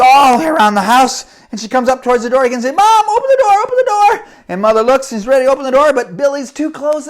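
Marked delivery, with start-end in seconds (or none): 2.8-4.3 high, loud frantic call; frantic plea. A narrator's reading. Girl chased by goat, shouting for Mom to open the door. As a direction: yell it at the door.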